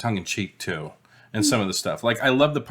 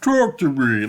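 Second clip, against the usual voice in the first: creepy possessed command; slower than usual. Retold or imitated. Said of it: "he's like".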